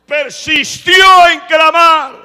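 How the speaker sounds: slow, high, booming proclamation; preaching at full force. Real, not acted. Preacher stressing the blind man's persistence, full-force shout. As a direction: hammer it out to the congregation.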